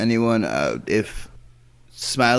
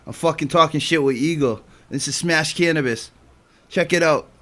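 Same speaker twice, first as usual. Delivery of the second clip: higher, louder put-on Boston accent; 7 semitones higher, 5 dB louder than usual. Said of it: "my Boston accent?".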